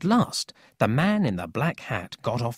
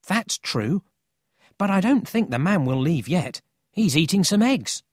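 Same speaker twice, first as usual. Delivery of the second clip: put-on higher, animated storybook boy; lightly voiced confident boy; 7 semitones higher, 4 dB louder than usual. A narrator's reading. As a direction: brush the worry aside. Paul reassures Emil.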